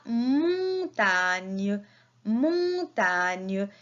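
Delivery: "montagne" very slowly, with long vowels; syllable by syllable. Shown as a demonstration. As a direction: stretch "mon", then "tagne", for learners. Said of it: "la syllabe que j'entends".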